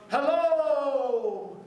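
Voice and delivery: long high call from a mountaintop; a high, loud hello into the distance. Acted. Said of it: "he would call out".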